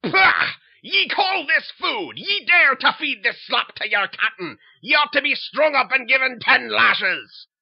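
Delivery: loud, harsh pirate-captain roar; bellowing captain. Acted. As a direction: bark orders like a tyrant at sea. Captain scorns the food.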